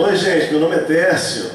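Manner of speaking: lively greeting. Shown as a demonstration. Pastor redoes the greeting with loud, high energy. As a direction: throw it out to the whole church. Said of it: "Tá bom?".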